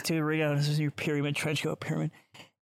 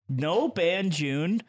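slow, drawn out; much slower than usual. Acted. Slowly repeating the event name.

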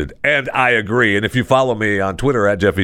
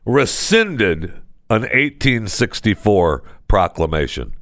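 normal talking voice

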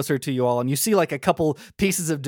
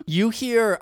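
usual speaking voice

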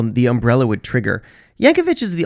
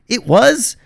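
slow, louder, higher triumphant reveal; dramatic reveal; 6 semitones higher, 4 dB louder, much slower than usual. Acted. Host unveiling the answer to listeners, slow triumphant reveal. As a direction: unveil it like a magic trick.

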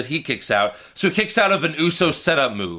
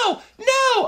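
imitated screaming. Mocking a wrestler screaming through the match, imitated high scream. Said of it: "Natalia's just screaming".

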